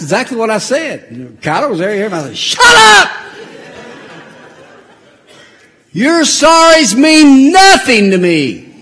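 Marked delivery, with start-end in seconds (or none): 2.4-8.7 much louder, higher, then slow; mock shout. Grandfather shouting down the apology, loud slow mock shout. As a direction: blast the first words, drag the rest.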